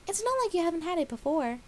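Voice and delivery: high, loud offhand voice; Pinkie. A narrator's reading. Pinkie teasing Dash after eating, high and loud. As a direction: toss it off lightly.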